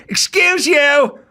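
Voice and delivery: mock indignant stranger's shout; indignant call-out. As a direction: call out sharply in a high, affronted voice. Acted indignant stranger cutting in.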